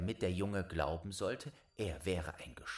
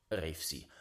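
usual speaking voice

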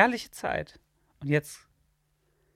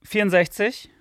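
show announcer; 6 dB louder, slower than usual. Acted. Announcing the episode number.

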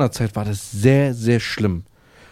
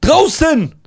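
high shrieked yell; imitated shout; 12 semitones higher, 5 dB louder than usual. Woman yelling through the window, high shout. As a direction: screech it at him. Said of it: "eine Frau sagt".

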